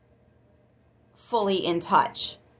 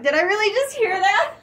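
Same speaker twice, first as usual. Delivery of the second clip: high, loud, through real laughter; laughing disbelief; 13 semitones higher, 7 dB louder than usual. Real, not acted. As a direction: crack up while asking. Cracks up at own whoop.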